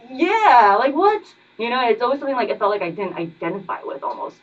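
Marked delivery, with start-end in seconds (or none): 0.1-1.2 incredulous retort. Retold or imitated. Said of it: "I'm like".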